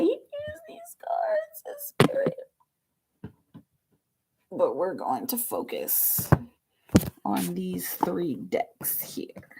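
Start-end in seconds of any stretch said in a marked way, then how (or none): 0.0-2.3 whisper. Real, not acted. Reader whispering to viewers over the cards. 7.2-9.5 slow hushed whisper. Real, not acted. Reader whispering the plan for the decks.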